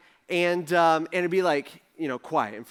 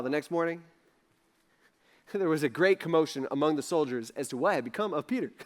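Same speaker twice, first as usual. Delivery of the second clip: ordinary delivery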